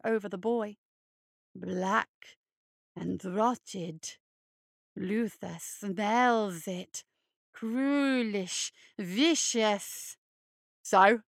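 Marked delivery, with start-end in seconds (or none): 1.6-10.2 hulking hare creature. Acted. Luther condemns the boy. 10.8-11.2 loud cocky so; loud brash retort; defiant retort. Acted. Obstinate boy retorts.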